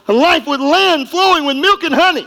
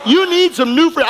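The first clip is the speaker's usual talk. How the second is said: ordinary talk, nothing marked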